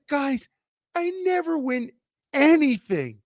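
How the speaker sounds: replayed giddy high-pitched joy; overjoyed disbelief. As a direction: voice leaping high, thrilled, retelling his win. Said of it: "I was just so happy".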